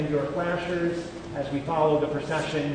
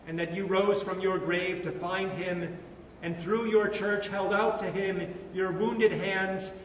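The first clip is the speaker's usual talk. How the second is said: solemn funeral prayer voice; 4 dB quieter than usual. Acted. Pastor leads funeral prayers.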